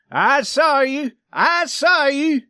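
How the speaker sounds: high, slow, insistent yell; shouted accusation. A narrator's reading. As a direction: hurl the accusation slowly and loudly. Swede accuses Johnny to his face.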